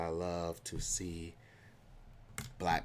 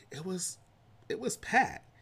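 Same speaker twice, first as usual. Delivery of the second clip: excited; 10 semitones higher, 4 dB louder than usual. Real, not acted. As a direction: voice jumps high and loud on packed. Hyping up the party.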